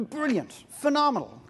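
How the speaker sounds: sarcastic high praise; mock praise. Speaker mocks entrepreneurs for complicating lives, sarcastic. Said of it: "mock praise of entrepreneurs".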